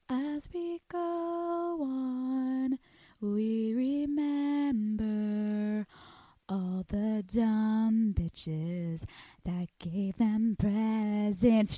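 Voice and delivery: slow, soft, solemn memorial intonation; hushed mock sermon; mock eulogy. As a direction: drag each phrase like a funeral speech.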